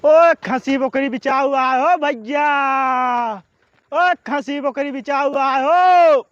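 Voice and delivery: loud, drawn-out vendor call; carrying sing-out to the whole lane; street hawker's cry. Acted. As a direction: call out for goats to buy, stretching the last word. Goat buyer crying through the village.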